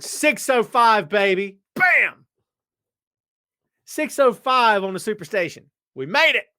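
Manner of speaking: high, loud triumphant shout; celebration. Real, not acted. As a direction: yell it out like the clock just hit the magic time. Celebrating the time on air.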